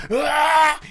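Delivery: high loud frustrated shriek; retold shriek. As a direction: let out an angry screech. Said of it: "And she goes".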